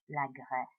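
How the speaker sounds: "la Grèce" said low, voice dipping on the è; dipped vowel in Grèce. Shown as a demonstration. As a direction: let the voice sink on "Grèce". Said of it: "so you dip your voice down".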